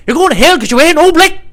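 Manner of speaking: very high, loud botched Irish squawk; badly faked Irish priest veering into hillbilly; Irish-hillbilly mix. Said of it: "mixture of an Irish guy".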